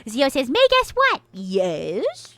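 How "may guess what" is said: a squeaky cartoon voice; high voice toggle. Reading Zio's chat aloud in a squeaky high toggle voice. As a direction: chat read far higher through a filter.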